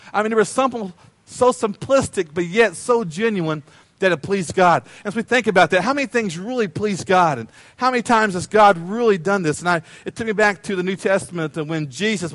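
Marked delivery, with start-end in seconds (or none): none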